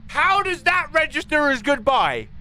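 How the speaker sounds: exasperated squeak. Real, not acted. Frustrated at voice recognition.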